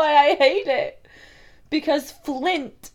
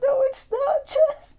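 near tears; 5 semitones higher than usual. Real, not acted. Upset over snack brand.

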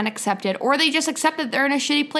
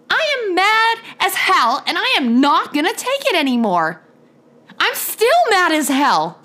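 still pissed; 5 semitones higher than usual. Real, not acted. Podcaster still mad one year into the show, angry declaration. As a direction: draw out each furious word, pitch lifting. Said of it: "I am still fucking pissed".

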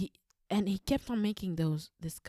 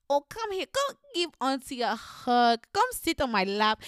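high, coaxing auntie, slowing on the hug; 6 semitones higher, 6 dB louder than usual. Retold or imitated. Auntie calling the child for a hug, high coaxing. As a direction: coax the kid onto your lap. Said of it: "And then she's like".

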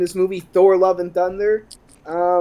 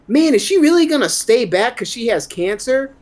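higher, incredulous inner voice; mock disbelief; 7 semitones higher than usual. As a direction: ask it like you can't believe the movie. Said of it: "What do you think to yourself?".

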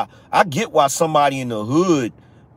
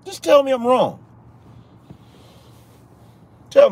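raised dare; 10 semitones higher than usual. Real, not acted. Repeats the dare after a long pause.

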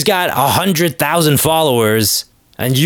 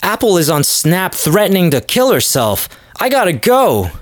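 put-on high, hurried valley-girl panic; flustered girl; 6 semitones higher than usual. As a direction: rush off pretending to be alarmed. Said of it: "She's like".

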